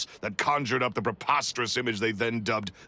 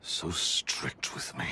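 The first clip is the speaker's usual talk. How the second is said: bitter whisper; 4 semitones lower, 5 dB quieter, slower than usual. Acted. Resentful memory of master.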